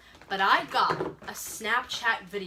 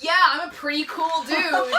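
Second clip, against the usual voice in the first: loud, higher mock-bragging voice; hammed-up cool-guy boast; boy showing off; 6 semitones higher, 8 dB louder than usual. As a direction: imitate a boy talking himself up.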